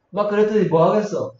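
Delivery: ordinary delivery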